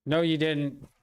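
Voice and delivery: loud, firm pushback; heated retort. Real, not acted. Arguing with David on the live, loud and heated. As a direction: shut down the accusation hard.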